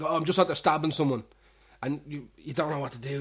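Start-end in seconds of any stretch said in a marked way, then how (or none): 0.0-1.2 casual mock boast, a bit louder; offhand bragging. Stranger in the pub casually boasting, louder. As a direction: toss it off like it's nothing. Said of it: "It's like".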